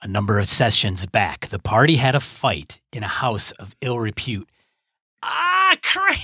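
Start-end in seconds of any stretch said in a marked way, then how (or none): 5.2-6.2 very high, pained outburst; sudden agonised curse; real cramp pain. Real, not acted. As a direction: yelp as the cramp seizes the leg. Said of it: "got a hellacious cramp in my leg".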